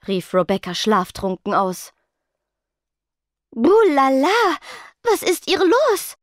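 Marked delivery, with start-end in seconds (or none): 3.5-6.2 high, puzzled voice, slowed down; baffled question. A narrator's reading. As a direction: ask in total confusion. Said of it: "fragte Rochelle verständnislos". (verständnislos, hoch und langsam)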